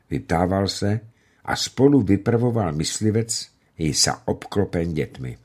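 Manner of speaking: usual speaking voice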